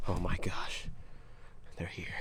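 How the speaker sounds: hushed creepy whisper; spooky whisper. Pretend. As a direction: whisper like they're watching. Swamp children joke.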